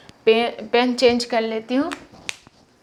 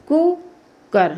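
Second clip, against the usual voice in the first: slow sounded-out word; 3 semitones higher, much slower than usual. Shown as a demonstration. Teacher demonstrating cooker to students, slow and drawn out. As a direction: stretch cooker so each sound is heard. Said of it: "छोटा ऊ में क्या हो जाता है".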